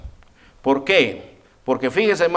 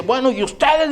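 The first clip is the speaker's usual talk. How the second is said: much higher, raised angry challenge; furious king; 9 semitones higher than usual. Retold or imitated. Furious king confronting the three young men, high angry challenge. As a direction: demand an answer like an outraged king. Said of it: "Y el rey furioso dice".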